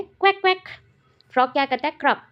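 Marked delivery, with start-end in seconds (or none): 0.0-0.6 higher mock quack quack; duck quack. Shown as a demonstration. Teacher quacks like a duck for the class. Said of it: "duck का sound क्या है".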